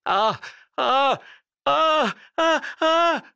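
high, loud, panicked gasps of terror; breathless screams of a man fleeing a ghost; terrified cries. A narrator's reading. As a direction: cry out in blind fright, rising each time. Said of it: "en s'écriant".

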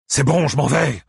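high, forceful angry exclamation; thundering. A narrator's reading. Ike giving up the struggle, thundering. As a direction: thunder it out to end the fight. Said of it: "tonna Ike".

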